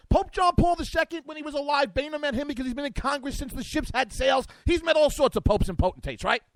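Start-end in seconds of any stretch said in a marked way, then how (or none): none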